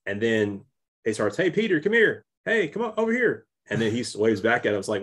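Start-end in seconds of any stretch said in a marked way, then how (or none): 1.4-3.5 hammed-up calling over to a stranger. Ned calling Peter over from across the alley, mock call.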